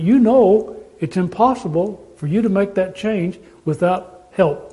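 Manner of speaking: ordinary talk, nothing marked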